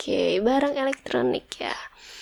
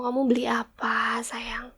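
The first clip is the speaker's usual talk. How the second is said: whisper; 7 dB quieter than usual. Acted. Whispered question to husband.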